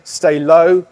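loud coaching call mid-exercise; shouted cue. Shown as a demonstration. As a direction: bark the cue while working hard. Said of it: "So here".

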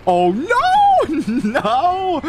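high loud laugh into a no. Real, not acted. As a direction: crack up in disbelief. Laughing at getting killed.

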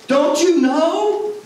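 high, slow, frantic mock panic; panicked shriek. Preacher mimics a panicked shopper with a high frantic shriek. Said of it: "she was freaking out".

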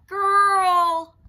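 huge high thundering shout; booming dragon call. Acted. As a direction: thunder the name into the night. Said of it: "thundered Dragon".

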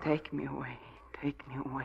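breathless whisper, barely there; whispered plea. Acted. Broken wife whispering to her husband. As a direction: beg in a whisper.

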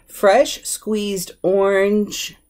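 slow, separated words stopping short at orange; phrase left hanging. Shown as a demonstration. Teacher shows what is expected without juice, slow and left hanging. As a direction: stretch each word, leave orange hanging. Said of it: "without hearing the word juice".

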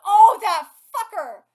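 very loud, drawn-out cursing; loud indignant outburst; outraged yell. Real, not acted. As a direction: yell it slowly at the villain. Outraged reaction to the villain.

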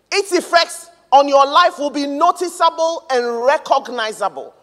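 loud, high, emphatic proclamation; full-force preaching. Real, not acted. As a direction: proclaim it boldly to the whole congregation.

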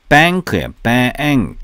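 "bank" with the "a" drawn out as two vowels; slow demo of the long "a" in "bank"; doubled vowel. Shown as a demonstration. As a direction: stretch "bank" into "ba-ank". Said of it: "애가 두 개입니다".

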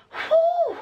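high puffing exhale; breathless whew. Real, not acted. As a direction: blow out a tired whew. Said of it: "So out of breath".

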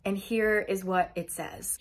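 ordinary delivery

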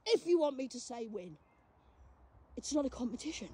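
softly dismissing the rivals; quiet aside. Real, not acted. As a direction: drop to a near murmur.

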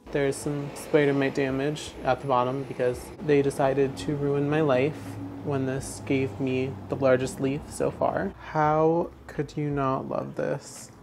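usual speaking voice

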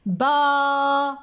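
باخرة in separate syllables, با stretched long and loud. Shown as a demonstration. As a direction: sound out باخرة slowly with gaps between syllables. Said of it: "لاحظوا جيدا".

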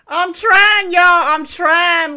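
loud, strained frustration; frustrated yelling. Real, not acted. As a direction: yell it out in frustration. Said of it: "Like, I'm so mad".

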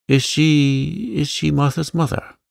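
a hint of faltering courage; lightly stammered question; stammering. A narrator's reading. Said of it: "she stammered".

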